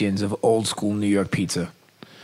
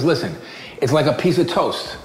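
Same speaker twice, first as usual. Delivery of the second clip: a hint of a Brooklyn chef, raised higher; lightly voiced chef, laughing at the end; New York pizza guy; 6 semitones higher than usual. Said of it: "he goes".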